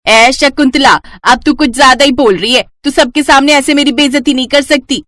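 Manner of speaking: high, loud, indignant; angry retort. Acted. Insulted aunt snapping angrily at Shakuntala. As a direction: bristle at the insult. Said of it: "क्यों गुस्सा हो रही हो?".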